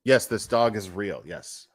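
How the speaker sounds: ordinary talk, nothing marked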